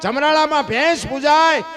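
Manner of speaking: rousing preaching. Acted. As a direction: hammer each place home to the crowd. Preacher lists worshipped animals loudly.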